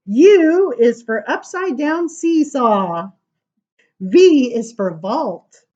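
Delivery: emphatic, slow-paced picture book reading; reading to an audience. Acted. Reading the alphabet book aloud to listeners, emphatic. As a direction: announce each letter to the room.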